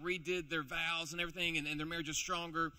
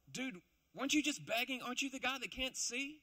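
surprised neighbors; 8 semitones higher than usual. Acted. Neighbors doubt it's him.

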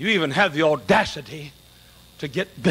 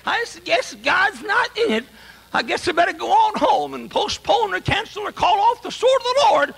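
a discouraged preacher giving up, voiced higher; mock quitter; 14 semitones higher than usual. Preacher imitating a discouraged man quitting, mock quitter voice. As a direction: play him whining his way out of the work.

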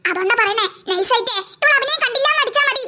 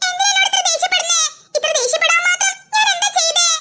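higher, hammed-up plaintive protest; overacted hurt; 4 semitones higher than usual. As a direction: overplay being unfairly scolded. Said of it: "over അഭിനയിക്കല്ലേ". (അമിതാഭിനയത്തോടെ നിഷ്കളങ്കത നടിച്ച്)

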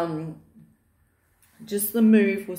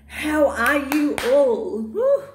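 louder and much higher whoop; excited cheer; 6 semitones higher, 6 dB louder, slower than usual. Real, not acted. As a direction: cheer them on with a woo. Cheering greeting to viewers.

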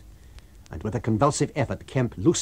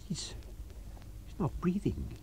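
hushed shock; 7 semitones higher, 10 dB quieter than usual. A narrator's reading. Discovers the man is dead.

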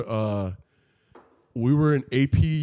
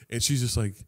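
normal talking voice

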